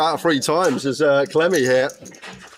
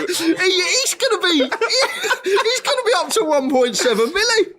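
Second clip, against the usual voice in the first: high laughing commentary. Real, not acted. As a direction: laugh through the call.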